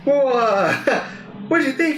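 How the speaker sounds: high, nervous, laughing reply; nervous squeak. Retold or imitated. Answering grandpa who saw his videos, high and nervous. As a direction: squeak it out, caught red-handed.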